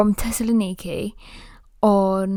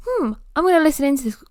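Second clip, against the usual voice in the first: quick, higher and louder, eager to eavesdrop; nosy excitement; 6 semitones higher, 5 dB louder, much faster than usual. Retold or imitated. Deciding to eavesdrop on the couple, eager. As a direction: rush it like a gleeful snoop. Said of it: "I was like".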